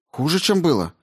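high, anxious query; worried question. A narrator's reading. Rao checks her injured partner's leg, worried question.

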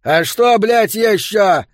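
loud, high scornful snap; irritated shout. A narrator's reading. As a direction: bite back at a stupid question. Yo snapping at newcomer.

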